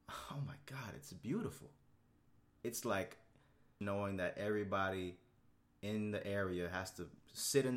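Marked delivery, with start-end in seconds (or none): 0.1-1.7 hushed awed whisper; whispered oh my God. Real, not acted. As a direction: breathe it out in awe. Whispered delight at rainy day.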